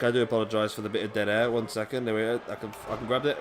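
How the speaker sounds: ordinary delivery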